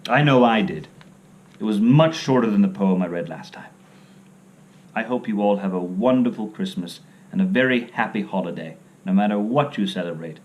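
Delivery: ordinary delivery, nothing marked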